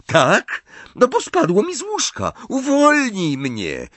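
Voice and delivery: very high, heated retort; indignant protest. A narrator's reading. Dangling boy demanding release, indignant.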